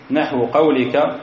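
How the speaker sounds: usual speaking voice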